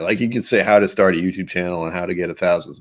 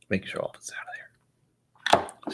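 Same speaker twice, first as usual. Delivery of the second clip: high airy whisper; slow breathy whisper to self; 9 dB quieter than usual. Real, not acted. Whispered aside while pouring.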